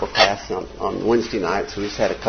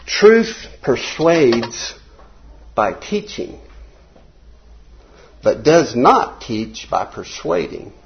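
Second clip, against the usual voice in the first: slow, deliberate phrase-by-phrase reading; really slow reading; much slower than usual. Real, not acted. Teacher reading the class a quote about truth, really slow. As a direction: read it out very slowly, with long pauses. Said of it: "I'll read it really slow".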